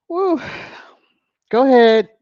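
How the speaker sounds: high, loud whoop; excited cheer. Real, not acted. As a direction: cheer them on loudly. Cheering on a fan.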